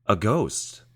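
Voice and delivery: a sudden rise of excitement on ghost; light up as it dawns on him; Michael realizing with a thrill; thrill of realization. Acted. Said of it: "with a thrill of realization".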